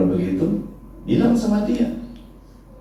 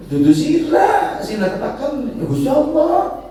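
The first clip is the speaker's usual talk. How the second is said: much higher, slow, exclaiming; Idris in Javanese; 14 semitones higher than usual. Retold or imitated. Idris telling the angel it was God, high and slow.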